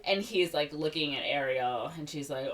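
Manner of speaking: ordinary talk, nothing marked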